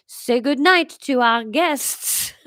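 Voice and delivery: put-on slow French-accented father; Larry prompting the boys.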